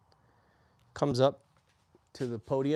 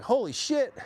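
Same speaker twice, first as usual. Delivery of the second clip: stunned exclamation; 12 semitones higher, 4 dB louder, slower than usual. Retold or imitated. Shock at plane hitting tower.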